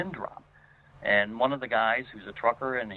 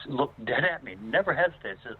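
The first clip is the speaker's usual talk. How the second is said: normal talking voice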